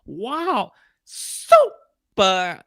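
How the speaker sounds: very slow, stretched-out mock wonder; imitating baffled ad woman. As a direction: mimic her struggling to process it, slowly. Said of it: "trying to process what she's holding".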